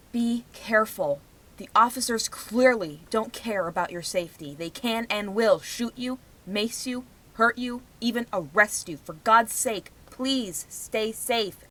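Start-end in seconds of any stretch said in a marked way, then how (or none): none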